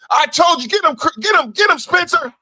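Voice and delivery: high, loud cheering yell; real hype shout at the screen; excited yelling. Real, not acted. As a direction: yell at the screen for your guy. Cheering on Spencer during clip.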